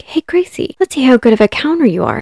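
high, bright call of her name, then a fast playful challenge; coaxing old stranger. Retold or imitated. Frank Howard teasing Gracie to count his cash, high and coaxing. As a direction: sweet-talk the child into a game.

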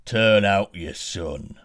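a slow, heavy giant's order; giant's demand. Acted. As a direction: boom it out slowly like a giant. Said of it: "said the giant".

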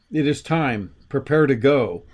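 ordinary talk

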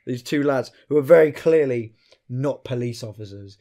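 ordinary talk

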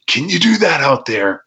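a high, eager plea; excited like Paulie. Retold or imitated. Angry co-booker suddenly won over, asking eagerly. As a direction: ask it like a booker who smells a gimmick. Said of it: "same thing as Paulie".

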